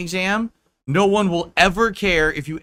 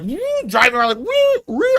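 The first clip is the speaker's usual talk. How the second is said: high, loud whooping cries; gleeful whoops; 10 semitones higher, 5 dB louder than usual. Retold or imitated. Friend whooping at the wheel, high gleeful whoops. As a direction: whoop like a thrill-seeking driver. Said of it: "they're like".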